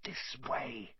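barely voiced whisper; mouthed whisper. A narrator's reading. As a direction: breathe it without voice. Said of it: "Harry mouthed to the others".

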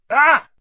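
sudden pained yelp. A narrator's reading. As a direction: cry out as a dragon bites. Hagrid bitten on leg.

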